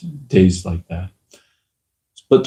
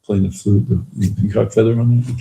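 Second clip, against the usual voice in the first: ordinary talk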